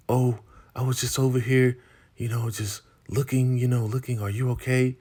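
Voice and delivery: high, light cover-up voice; imitating a woman covering her tracks; feigned innocence. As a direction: play innocent when caught snooping.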